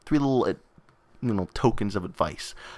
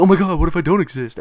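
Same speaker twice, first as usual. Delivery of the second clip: higher, louder mock alarm; mock existential panic; 7 semitones higher, 5 dB louder than usual. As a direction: pretend to be stunned by a big thought. Imagined listener panicking over philosophy.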